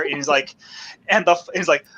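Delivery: normal talking voice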